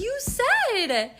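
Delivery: high-pitched wronged protest; indignant reproach. Retold or imitated. Woman holding boyfriend to his word, indignant. Retold confrontation over missing flowers.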